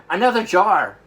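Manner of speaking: a loud, high cry of triumph; excited cry. Acted. Giant calls out over his prize catch. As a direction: shout it out as the jar fills. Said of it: "he cried".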